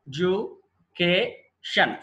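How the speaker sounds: Education pulled apart into four slow beats; syllable by syllable. Shown as a demonstration. Teacher repeats the four-syllable count, very slow and separated. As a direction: say education as E, DU, CA, TION, pausing between. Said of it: "four syllables ఉన్నాయి".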